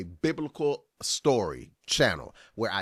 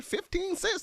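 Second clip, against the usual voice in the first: much higher, disbelieving fifteen cents; can't believe the price he named; incredulous; 4 dB quieter than usual. Real, not acted. Reacting to the preacher's fifteen cents, incredulous.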